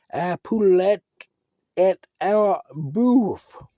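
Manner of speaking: a hammed-up French accent, drawn out; mock French. Man to his cat, joking French on the treat label. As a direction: read the label like a fancy French menu.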